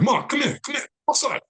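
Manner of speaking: high-pitched excited call; urgent calling out. Retold or imitated. Son running into the store to fetch his mother, urgent. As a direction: re-enact a rushed, high "come here".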